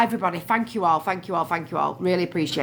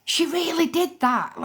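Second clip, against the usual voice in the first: incredulous; 11 semitones higher than usual. Retold or imitated. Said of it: "and I'm like".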